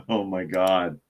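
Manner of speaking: ordinary talk, nothing marked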